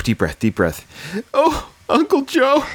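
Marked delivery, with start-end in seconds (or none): 1.3-2.6 high, slow, hammed-up wail; fake wailing. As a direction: wail it like a paid mourner.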